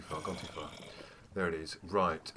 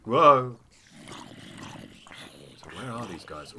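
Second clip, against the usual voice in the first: normal talking voice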